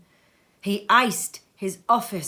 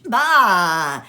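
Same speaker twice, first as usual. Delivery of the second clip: loud, scornful bark of contempt; scornful bah; 12 dB louder than usual. Acted. Scrooge dismissing his nephew's greeting, loud scornful bark. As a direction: spit it out in disgust.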